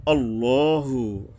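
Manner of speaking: slow আল্লাহু with full rounded lam; heavy Allah. Shown as a demonstration. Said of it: "আকার উচ্চারণ করা যাবে না".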